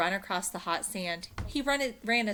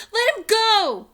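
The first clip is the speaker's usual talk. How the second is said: angry demand; 15 dB louder than usual. Acted. Annie confronts pirate.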